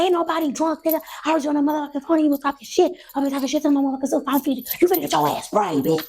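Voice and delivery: high, fast re-enacted tirade; going crazy. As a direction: rant threats in a high rush. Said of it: "I just come out going crazy".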